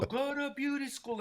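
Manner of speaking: lightly voiced heavenly angel; a hint of a divine proclamation. Imagined angel announcing a calling, lightly voiced and otherworldly. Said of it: "it's gonna go".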